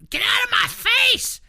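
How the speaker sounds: high slow shrieking yell; toddler scream. Retold or imitated. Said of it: "And I'm like".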